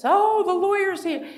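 high, hushed adoring voice; mock reverence. Mocking how everyone fawned over the lawyer, reverent awe. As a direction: greet him like a saint arriving. Crowd treats lawyer like Jesus.

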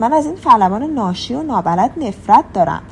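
ordinary delivery, nothing marked

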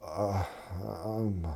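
soft, dazed patient mumble; confused. Acted. Patient fails to answer how he's feeling, acted confused and faint. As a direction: play a patient who can't track the question. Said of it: "They're confused".

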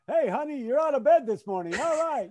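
high, loud race-announcer boom aimed at the wife; booming announcer call. Pretend. Husband booms at his wife as if she just finished a race, playful announcer call. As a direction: announce her like she's crossing the finish line.

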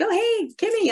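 high, eager hello; bright excited greeting. Retold or imitated. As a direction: greet an old friend with delight. High-school friends greeting her.

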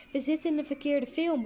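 calling out. A narrator's reading. Girl telling friends of the cinema mistake, calling out. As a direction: exclaim it to your friends. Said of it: "riep ik weer".

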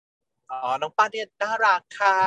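high, slow, gushing praise; sweet praise. Retold or imitated. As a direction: gush over him slowly and sweetly. Boasting sweetly about protégé.

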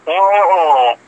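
ordinary talk

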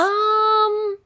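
high, stalling um; mock terrified. Actresses asked for an accent on the spot, mock terrified. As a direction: imitate a panicked actress stalling. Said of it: "horrified, terrified, but they're like".

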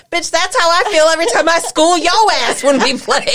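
quick, high-pitched trash talk; mock boast through laughter; imagined daughter's comeback. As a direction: rattle off a cocky put-down. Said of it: "I'm surprised your daughter didn't say".

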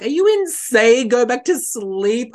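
high, loud panicked disbelief; panicked at the thought of sleeping; freaking out. Retold or imitated. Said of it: "freaking out".

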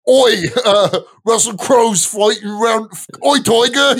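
loud, high mock Australian shouting; South Park style Aussie bluster; Australian accent. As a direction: bark out Oi, Tiger! in a hammed-up Aussie accent. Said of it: "the only thing I honestly know to do".

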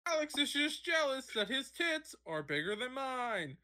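high-pitched parody of a TV reporter; hammed-up field reporter delivery; sideline reporter. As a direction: overdo the on-the-scene report, punching mid-sentence words. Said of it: "that's how every sideline reporter fucking talks".

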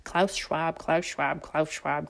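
rapid quiet repeat of "Klaus Schwab"; tongue twister, fast. Shown as a demonstration. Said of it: "say that five times fast".

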